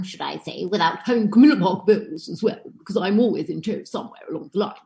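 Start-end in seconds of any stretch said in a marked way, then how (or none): 0.6-2.6 the Queen. Retold or imitated. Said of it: "Who are you doing".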